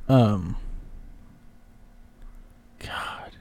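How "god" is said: whispered. Real, not acted. Whispered exclamation.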